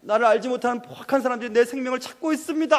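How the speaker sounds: loud, high, urgent pleading; anguished prayer. Acted. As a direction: cry out to God as a hunted David. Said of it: "너무 마음이 아파서 그렇게 기도하고".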